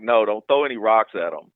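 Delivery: ordinary delivery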